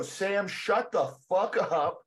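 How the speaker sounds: mock-indignant rebuke, drawn out; incredulous telling-off, lightly acted; imagined scolding friend. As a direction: scold a friend in slow, emphatic disbelief. Imagines best friend scolding him.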